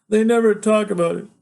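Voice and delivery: high, loud, urgent insistence; agitated protest. Acted. Son insisting to father that he remembers, agitated loud protest.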